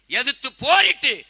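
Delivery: loud, high, slow rallying cry; fiery declamation. Real, not acted. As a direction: drive it out forcefully to the crowd. (ஆவேச மேடை முழக்கம்)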